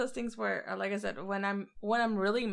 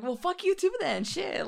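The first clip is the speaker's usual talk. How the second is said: higher-pitched, exasperated comeback; mock indignation; 10 semitones higher than usual. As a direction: act out fed-up protest at being killed. Snapping back at repeat killer.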